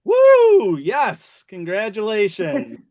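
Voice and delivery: high, loud woo; hyped giveaway announcement. Real, not acted. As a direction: whoop for the winner. Cheering the giveaway prize.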